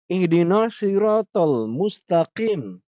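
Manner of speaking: slow, drawn-out recitation of Ihdinas siratal mustaqim; Quran recitation. Shown as a demonstration. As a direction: recite it slowly with a heavy q in mustaqim. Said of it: "Ah, ini mustaqim".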